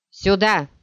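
loud call over distance. Acted. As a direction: shout them over. Paul waving, calling friends. (громкий зов)

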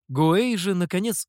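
usual speaking voice

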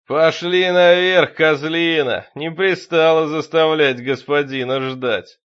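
loud, slow, bellowed order; furious command. A narrator's reading. Possessed deacon drags the priest upstairs, bellowing orders. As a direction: bellow it, stretching the words.

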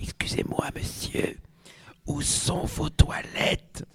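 soft whispered question; discreet whisper. Acted. Blonde to the barman, whispering. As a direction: lean in and whisper it. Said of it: "se penche vers son oreille".

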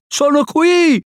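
high-pitched answering call; high drawn-out holler; calling back. A narrator's reading. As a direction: call back across the river. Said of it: "rispose Mowgli".

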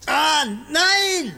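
high pained shout of fear; pained cry. Acted. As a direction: cry out as the needle goes in. Cries out at injection.

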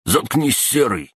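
high-pitched snapped order; sudden bark. A narrator's reading. Third goon silencing Серый, a sudden high bark. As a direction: cut the mate off sharply, starting high. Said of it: "неожиданно рявкнул третий".

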